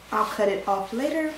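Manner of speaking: normal talking voice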